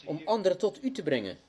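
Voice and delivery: earnest prayer. Acted. Pastor praying before his small congregation, earnest and pleading. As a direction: pour out the heart in prayer.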